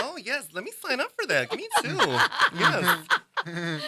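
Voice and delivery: mock an overeager woman signing up, laughing by the end; eager lady. Lady signing up for the misheard program, high-pitched eagerness.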